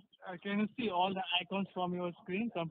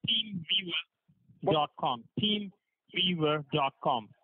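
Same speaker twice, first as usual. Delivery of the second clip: slow, word by word; slow dictation; much slower than usual. Acted. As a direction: spell it out slowly for him. Dictating a web address.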